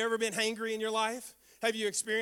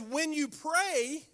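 slow quote; 4 semitones higher, much slower than usual. Shown as a demonstration. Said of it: "he reiterates it again".

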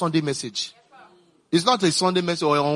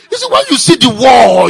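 full preaching shout, much louder and higher; 8 semitones higher, 14 dB louder than usual. Real, not acted. Preacher shouting to the church about the war, loud. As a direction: cry it out over the crowd.

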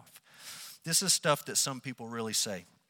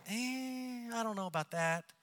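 higher, softer noncommittal waver; skeptical hedging; 8 semitones higher, 6 dB quieter than usual. Acted. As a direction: hedge like a reluctant doubter. Voicing doubters hedging on hell.

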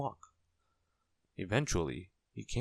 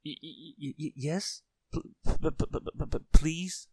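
high stutter ending in a loud burst; quivering stammer; 8 semitones higher, 6 dB louder than usual. A narrator's reading. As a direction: stumble fearfully over each first sound. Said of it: "quivered and shook and said".

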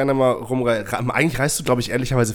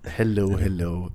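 sick voice; 4 semitones lower, 4 dB quieter than usual. Real, not acted. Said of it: "Ich kling scheiße, sorry, ich bin krank".

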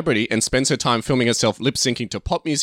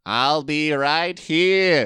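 higher, very slow alien call; E T screaming; 5 semitones higher, much slower than usual. Acted. E T runs down the hallway, slow excited alien cry. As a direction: draw out each word as an excited alien. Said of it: "runs down the hallway screaming".

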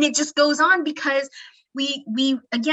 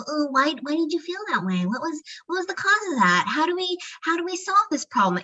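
overthinking brain voice; 5 semitones higher than usual. Retold or imitated. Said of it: "part of your brain to go".

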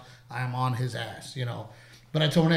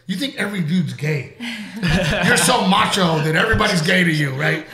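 1 mock wife's jab; 5 semitones higher, 8 dB louder than usual. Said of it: "Renee goes".

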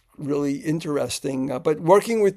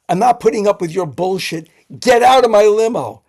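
louder, angry outburst; furious driver; 4 semitones higher, 8 dB louder than usual. Retold or imitated. Limo driver losing her temper at the director, angry and loud. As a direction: snap and throw them out. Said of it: "she went nuts".

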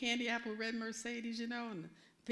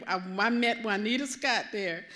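ordinary talk, nothing marked

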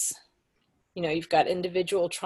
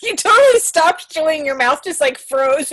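excited; 8 semitones higher, 9 dB louder than usual. Real, not acted. Teasing friend, laughing burst.

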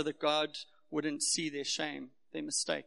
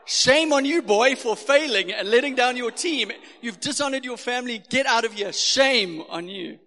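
loud, high hammed-up scolding with a laugh at the end; mock scolding; 7 semitones higher, 15 dB louder than usual. What the foreign parents heard, a hammed-up scolding shout. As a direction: play the horrified parents' idea of a cruel scold. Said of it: "They thought she was a monster".

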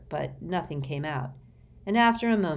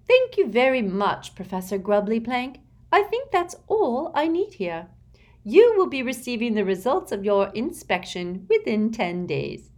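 high, saccharine, falsely polite; sweetly dismissive official; Umbridge voice; 6 semitones higher, 6 dB louder than usual. A narrator's reading.

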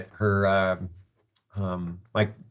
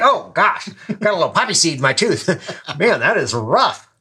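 high, loud mock fuss over something trivial; oblivious surgeon; 8 semitones higher, 6 dB louder than usual. Surgeon fussing over his tooth after grim news, oblivious. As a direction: imitate a self-absorbed doctor, laughing partway. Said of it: "personality of a surgeon with Asperger's".

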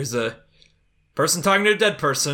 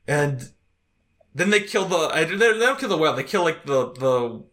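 ordinary delivery

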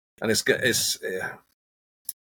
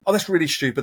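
higher-pitched exasperated telling-off, lightly acted; mock scolding; 7 semitones higher, faster than usual. Coach acting out an adult telling off a child, mock scolding. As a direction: scold a child, half-hearted.

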